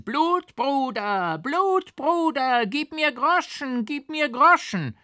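very high, slow loud witch wail; hideous screaming hag. A narrator's reading. Mad old woman begs the rider, hideous wail. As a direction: drag out each word like a crazed crone. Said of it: "die Alte schrie grässlich dazwischen".

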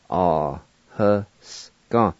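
"Ah, huska" slowly, broken into syllables. Shown as a demonstration. Teacher pronouncing set down for learners, slow syllables. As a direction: take "Ah, huska" apart piece by piece. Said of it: "now I'll pronounce these words".

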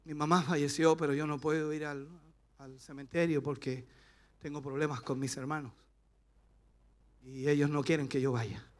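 mild imitation of a mourner; imitating a grieving member. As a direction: tell the pastor your sad excuse quietly. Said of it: "me dice".

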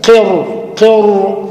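قر، قر stretched, ر pushed toward the ط; slow drilled syllable. Shown as a demonstration. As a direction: drill قر slowly, ر heavy and close to the ط. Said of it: "ادي الراء كده وقربها للطاء".

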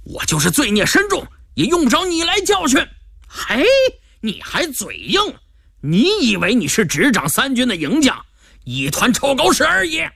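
high, indignant acted anger; furious retort. A narrator's reading. Prisoner answering back to his escort, furious. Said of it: "另一个声音怒气冲冲道".

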